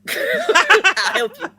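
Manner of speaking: high, drawn-out laughing words; real laughter breaking into the words. Real, not acted. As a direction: barely get it out through laughter. Joking offer repeated through laughter.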